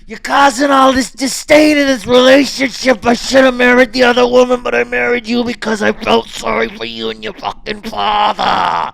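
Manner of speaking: over-the-top screaming match; screaming rage. Acted. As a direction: scream it at your wife, all control gone. Acted marital screaming match.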